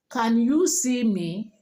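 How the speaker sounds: Nigerian question, slow, pitch peaking on "you"; typical Nigerian accent. Shown as a demonstration. Teacher shows how a Nigerian asks, Nigerian accent. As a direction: Nigerian accent, no big lift on "me". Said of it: "as a typical Nigerian, you will say".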